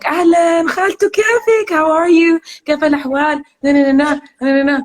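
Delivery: high, bright-voiced greeting in Sudanese Arabic to an auntie; sweet auntie greeting. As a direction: pitch way up and greet your elder aunt warmly and loud. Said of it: "I'll literally speak to aunties and be like".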